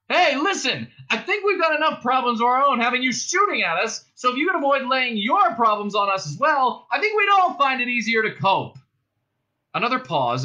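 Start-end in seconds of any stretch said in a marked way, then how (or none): 0.1-8.8 high, loud appeal; shouting out. A narrator's reading. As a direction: shout a reasoned complaint across the room. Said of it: "Ford shouted out".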